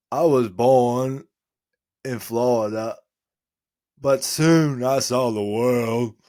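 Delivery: Forrest Gump impression. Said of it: "Forrest Gump".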